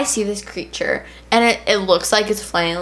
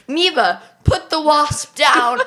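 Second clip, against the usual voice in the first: mock yell, higher and drawn out; retold parents yelling; 6 semitones higher, slower than usual. Child imitating both parents yelling at her, mock yell. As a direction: imitate exasperated parents calling out slowly. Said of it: "Both parents are yelling at me".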